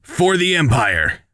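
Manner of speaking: high, drawn-out rallying cry; exclaimed battle cry. Acted. Traitor declares allegiance before taking aim, exclaimed battle cry. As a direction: proclaim loyalty in a slow, high cry. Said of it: "Vade exclaims".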